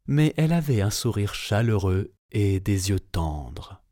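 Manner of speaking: usual speaking voice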